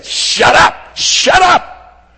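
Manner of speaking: loud, sharp shout to silence someone; crowd shouting. Acted. Crowd silencing blind Bartimaeus, angry shouting. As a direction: shout him down hard. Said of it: "and everybody saying".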